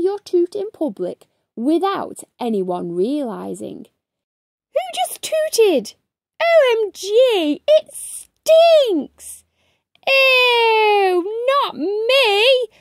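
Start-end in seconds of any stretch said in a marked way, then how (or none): 4.7-12.7 high, loud squeal of disgust; high-pitched grossed-out squeals; disgusted kid voices. Acted. Kids react to a stink.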